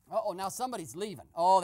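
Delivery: ordinary talk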